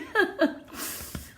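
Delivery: breathy laugh through tears; laughing off her own tears; teary laugh. Real, not acted. Laughing at herself after tearing up in front of her team. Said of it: "I'm such a baby".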